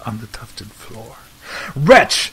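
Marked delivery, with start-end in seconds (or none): 1.8-2.3 a loud cry of wretch; louder accusing cry. Acted. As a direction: hurl the word at the bird. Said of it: "I cried".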